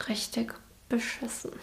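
under her breath, half-censoring herself; whispered swear. Real, not acted. Crude word said under her breath.

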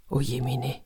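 breathy whisper of despair, barely voiced; whispered sigh. A narrator's reading. Narrator whispers the servant's despair to children, hushed. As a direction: whisper it like a worried storyteller.

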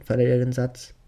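ordinary delivery, nothing marked

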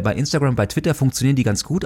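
normal talking voice

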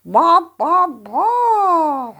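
loud hen cluck. Acted.

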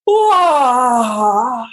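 big loud yawn, sliding down in pitch; loud open-mouthed yawn. Shown as a demonstration. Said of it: "so ein lautes Gähnen, auch gerne mit offenem Mund". (lautes Gähnen mit offenem Mund)

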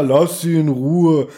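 slow, stilted imitation of the porter; imitating the porter. Porter telling Justus to leave the girl alone, stilted. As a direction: draw it out like a badly acted porter. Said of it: "Und dann sagt der Fördner".

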